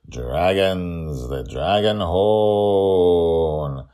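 loud, high, slow outro call; drawn-out showman sign-off; closing announcement. Acted. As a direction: ring out the credits like a serial host. Closing credits and hashtags read out.

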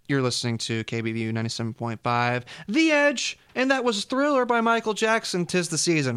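high, loud, excited DJ voice; radio DJ. Acted. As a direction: hype it like a radio host. DJ back-announce live.

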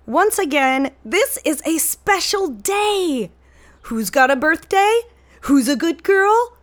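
put-on high, slow, gushing baby talk. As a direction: coo it like cooing at a puppy. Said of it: "my mom likes to send, uh, uplifting texts".

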